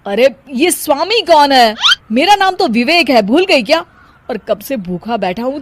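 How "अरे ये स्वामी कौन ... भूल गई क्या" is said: loud, higher, startled and indignant; what on earth, who is Swami; baffled outburst. Acted. Husband baffled by wife's 'Swami'. (हैरान होकर ऊँची आवाज़ में)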